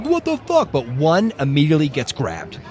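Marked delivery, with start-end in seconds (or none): 0.0-0.7 high startled mock cry; high imitated panic; panicked soldier. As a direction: blurt it in shock.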